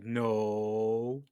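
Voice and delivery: mock innocent no; side-eye denial. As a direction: deny it with a suspicious side-eye. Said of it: "Side-eye".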